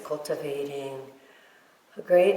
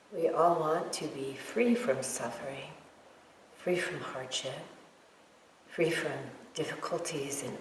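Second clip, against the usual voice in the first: guided meditation. Real, not acted. Teacher guiding meditators to feel love for all beings, guided meditation. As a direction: lead them gently, leaving silence between phrases.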